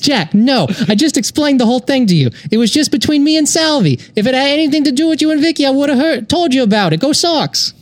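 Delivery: put-on high, tough-guy Boston accent; Boston Joe Pesci. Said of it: "I'm gonna be a Boston Joe Pesci".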